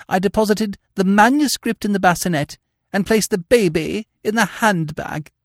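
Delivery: put-on a prim old governess owning up to her blunder; prim governess confessing. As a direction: confess it with wounded dignity. Miss Prism's long confession.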